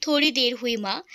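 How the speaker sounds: ordinary delivery, nothing marked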